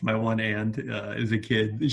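ordinary talk, nothing marked

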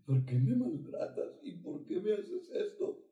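mild tearful whine; a touch of sobbing reproach; crying plea. Retold or imitated. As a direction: plead like a hurt, weeping creature. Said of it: "Y llorando así".

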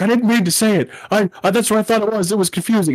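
high-pitched imitation of a panicked cartoon dad; mock flustered backpedalling; flustered excuse. Cartoon character flustered after a slip.

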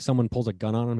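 ordinary talk, nothing marked

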